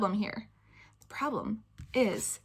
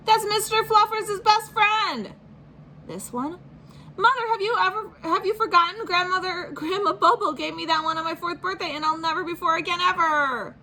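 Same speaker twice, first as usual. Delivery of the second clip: put-on melodramatic boy; 9 semitones higher than usual. Spencer lecturing mom dramatically, high indignant kid voice. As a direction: overplay it like a wounded child.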